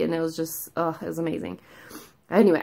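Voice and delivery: ordinary talk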